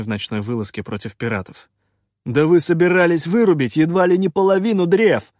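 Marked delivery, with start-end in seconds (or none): 2.2-5.3 high, loud accusation; indignant exclamation. A narrator's reading. Шутай exposes the plan to fell trees, indignant. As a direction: accuse him at full outraged volume. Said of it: "возмущённо воскликнул Шутай".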